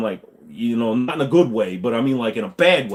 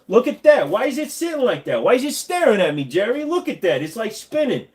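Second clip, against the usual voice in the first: put-on bewildered old guy; 9 semitones higher than usual. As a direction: squeak it out like a confused uncle staring at a spaceship. Bewildered by new computer setup.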